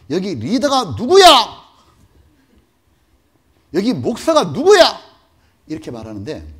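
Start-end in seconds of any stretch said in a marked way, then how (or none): 0.1-5.0 loud, high barking interrogation; militant's shouted demand. Retold or imitated. Captor shouting at hostages, loud and high. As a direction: bark it like an armed captor. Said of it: "그러더니 뭐라 했냐면".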